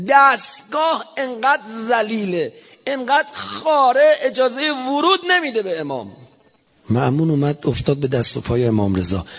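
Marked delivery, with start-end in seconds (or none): 0.1-6.2 scornful lament. Acted. Scornful preaching about the regime.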